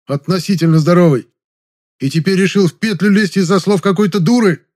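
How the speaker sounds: loud, high, furious dressing-down; worked-up anger. A narrator's reading. Veteran's friend berates him for trying suicide, angry. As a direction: hammer him with angry reproaches, pitched well up. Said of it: "Я уже завёлся так, что от злости".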